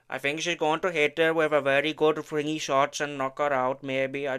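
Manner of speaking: light put-on Indian accent; half-hearted Indian accent impression; mock Indian accent. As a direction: slip into a jokey Indian accent. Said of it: "I did say I wasn't gonna do that".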